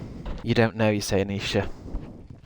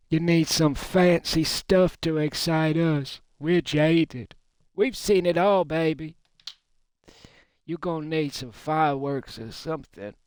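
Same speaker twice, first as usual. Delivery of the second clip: jaded audience voice; 6 semitones higher than usual. Pretend. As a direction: play a spoiled crowd that has seen it all.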